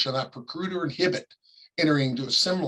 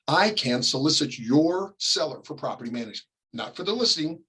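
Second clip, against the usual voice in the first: ordinary talk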